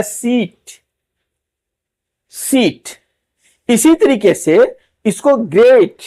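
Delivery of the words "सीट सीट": सीट with a long, drawn-out "ee"; long ee demo. Shown as a demonstration. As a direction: stretch the vowel in सीट, "seeet". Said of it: "इसको खींच के पढ़ा जाता है". (सीट को लंबा "ई" खींचकर बोलना)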